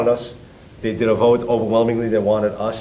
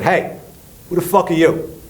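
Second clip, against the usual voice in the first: high, raised-voice confrontation; retold heated challenge; angry challenge; 8 semitones higher than usual. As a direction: demand to know who he is. Acted confrontation at the stage.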